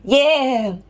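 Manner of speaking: high twangy call; twangy vocal warm-up; twang. Shown as a demonstration. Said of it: "we're gonna twang".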